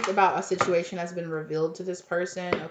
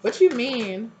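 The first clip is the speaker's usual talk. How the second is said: very high squeaky what, then louder; can't believe the confession; stunned disbelief; 7 semitones higher, 5 dB louder, much slower than usual. Pretend. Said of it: "You're gonna be like".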